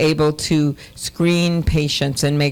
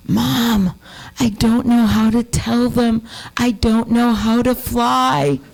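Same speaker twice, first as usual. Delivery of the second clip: put-on higher, slow little girl voice, not a real whisper; child's confession; 7 semitones higher, slower than usual. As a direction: confide it like a shy four-year-old. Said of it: "she whispers at me".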